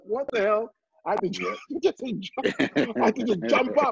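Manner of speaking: put-on excited Caribbean accent, pitch soaring. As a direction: burst out in a high Caribbean accent. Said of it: "[name] switches to his Caribbean accent".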